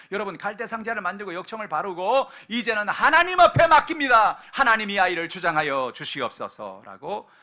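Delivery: full-volume sermon; preaching at full force. Real, not acted. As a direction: build to a fervent climax. Preaching climax.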